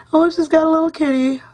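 delighted. Real, not acted. Spots kitty on card.

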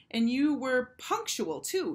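high, slow mock praise; sarcastic teacher. A narrator's reading. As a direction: stretch it out with dry sarcasm.

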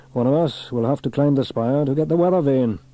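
lightly voiced firm fireman; Fireman Sam, resolute. A narrator's reading. Sam telling Trevor someone must climb, lightly resolute.

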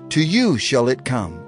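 plain reading voice

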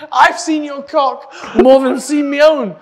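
high, loud, helpless laughter through the words; real laughing outrage; laughing exclamation. Real, not acted. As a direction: shout it while cracking up. Laughing accusation at friend.

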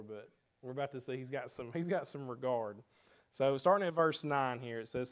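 ordinary delivery

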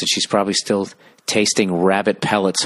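ordinary talk, nothing marked

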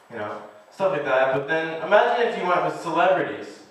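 ordinary talk

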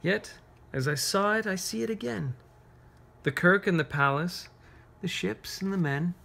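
see the town again in your mind; reading a poem to children. Acted.

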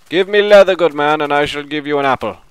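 mock-gallant trader. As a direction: play a grand merchant striking a deal. Role-plays a bargain with a villager.

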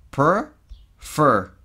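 Per and fer broken apart, each loud; syllables split. Shown as a demonstration. Teacher splitting prefer into loud syllables. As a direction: chop prefer into two hits. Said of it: "Es como si esas vocales no existieran".

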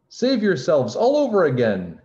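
high, loud cry of alarm; yelled warning. A narrator's reading. Rabbit warning everyone as it runs by, yelling. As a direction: yell it out as a panicked warning. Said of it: "he yelled".

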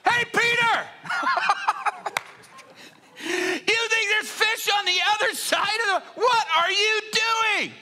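high, loud jeering across the water; mocking shout. Rival fishermen jeering at Peter, loud high mockery. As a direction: heckle crazy Peter from the other boats. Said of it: "the other guys are like".

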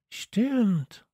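high-pitched cry of recognition; exclaiming. A narrator's reading. Child suddenly remembers the place, exclaiming. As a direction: call it out high, as if just remembering. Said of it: "rief Radieschen".

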